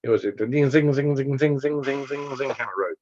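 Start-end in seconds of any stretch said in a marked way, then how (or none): 0.7-2.5 rapid-fire run of zings; zing back and forth like hairpin curves; string of ringing zings; zinging sound effect. Shown as a demonstration. Mimicking a curvy road.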